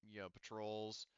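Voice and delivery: normal talking voice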